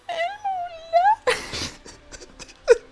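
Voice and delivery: very high, loud Elmo squeal. Shown as a demonstration. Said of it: "do this thing in an Elmo voice".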